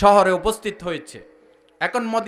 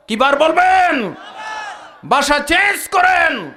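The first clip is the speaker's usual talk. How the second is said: high, slow, ringing shout; shouted exhortation; 6 semitones higher, slower than usual. Real, not acted. Preacher telling Muslims to change their language, shouted exhortation. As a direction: demand it from the crowd, stretching the last word.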